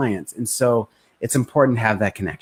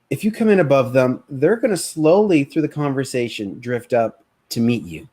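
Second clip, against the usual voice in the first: normal talking voice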